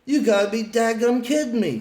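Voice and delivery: shocked outburst. Retold or imitated. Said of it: "I went".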